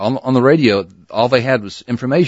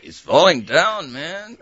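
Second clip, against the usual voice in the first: put-on Jose Jimenez accent; 7 semitones higher, slower than usual. Hank's stock reply retold.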